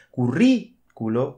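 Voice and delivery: currículo said slowly with stress on the rí; teacher slowly shows students the stress in currículo; stressed on the first syllable. Shown as a demonstration. Slow currículo with the stress clearly on rí. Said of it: "porque son esdrújulas".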